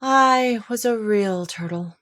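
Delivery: slow, mournful, heavy with a sigh. Acted. Mock Turtle to Alice and Gryphon, sighing sadly. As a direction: let it out like a long lament. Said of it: "with a deep sigh".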